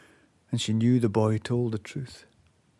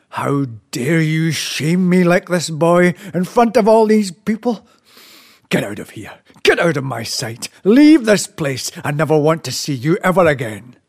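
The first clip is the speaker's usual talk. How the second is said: a furious father's outburst, higher and louder; angry; 4 semitones higher, 6 dB louder than usual. Acted. Chief shouts at his son in front of the guests, furious. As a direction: rage at the boy who shamed him. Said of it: "A great cloud of anger erupted from the chief".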